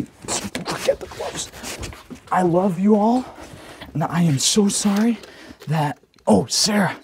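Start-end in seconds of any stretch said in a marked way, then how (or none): 0.0-1.5 slow whispered aside; whispered giving-up aside. Real, not acted. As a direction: mutter it to yourself, giving up. Muttered aside while packing.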